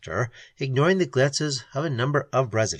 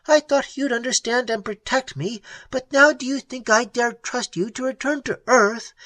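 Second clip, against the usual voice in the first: high, blazing angry outburst; woman blazes at the self-righteous hero; sudden anger; 11 semitones higher than usual. Acted. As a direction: flare up at him in anger. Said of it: "She blazed in sudden anger".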